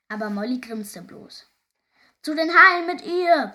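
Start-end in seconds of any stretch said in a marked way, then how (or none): 2.2-3.5 high, very loud pirate yell; rowdy pirate shouting to the crew; bellowing roar. A narrator's reading. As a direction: roar out a bloodthirsty demand. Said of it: "brüllte denn Harald die Holzhand".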